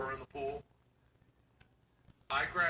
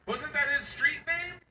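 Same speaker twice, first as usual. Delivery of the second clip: put-on higher, sheepish teen girl voice; daughter's voice; 10 semitones higher than usual. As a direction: play the embarrassed daughter, higher and quick at the end. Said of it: "She goes".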